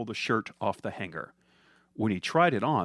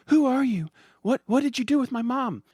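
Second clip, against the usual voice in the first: high, loud, frightened questions; a terrified girl demanding answers; scared child; 5 dB louder than usual. Acted. Daughter confronting the villain, frightened and loud.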